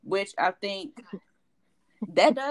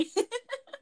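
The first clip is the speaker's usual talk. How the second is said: a high, breathy burst of laughing; high burst of laughter. Real, not acted. Cracking up at friend story.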